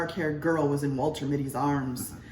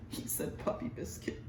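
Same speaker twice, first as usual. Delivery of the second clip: soft unvoiced whisper to a friend; whispered gossip; 12 dB quieter than usual. Woman whispering to her companion about Mitty, hushed. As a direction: lean in and whisper it.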